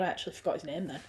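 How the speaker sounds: usual speaking voice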